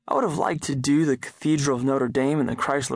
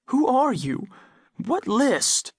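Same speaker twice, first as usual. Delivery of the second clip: frightened sobbing; 10 semitones higher, slower than usual. A narrator's reading. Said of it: "my mother sobbed".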